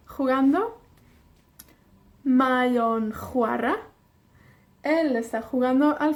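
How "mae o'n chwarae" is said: slow, careful delivery; slow model phrase. Shown as a demonstration. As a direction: model it slowly for learners. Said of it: "teacher modelling slowly".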